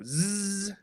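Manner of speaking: long voiced zzz; sustained Z buzz. Shown as a demonstration. Teacher demonstrates for a student, long Z buzz. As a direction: hold a buzzing z sound. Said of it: "say a Z sound".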